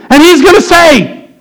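high, emphatic, building sermon delivery; full-force preaching. Real, not acted. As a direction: blow the warning horn from the pulpit.

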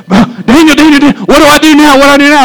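hammed-up confused calling for help; panicked plea. Church member lost at the fourth bowl, mock panicked plea. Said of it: "you're gonna say".

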